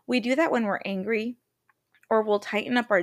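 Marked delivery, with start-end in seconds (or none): none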